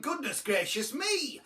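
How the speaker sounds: high shocked exclamation; exclaimed. A narrator's reading. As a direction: cry it out in astonishment.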